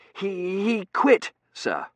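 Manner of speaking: high, halting confession; nervous stammer. Acted. T J admitting to Dunworthy that Chiswick quit, nervous stammer. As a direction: repeat the first word, then get it out. Stammered bad news to superior.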